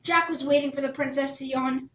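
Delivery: ordinary delivery, nothing marked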